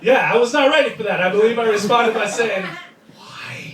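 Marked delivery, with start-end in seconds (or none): 3.1-3.7 barely-there whispered question; stunned tiny voice. Acted. Man responding to his girlfriend's surprise move, stunned whisper. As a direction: squeeze it out, hushed and shocked. Stunned reply to an unexpected act.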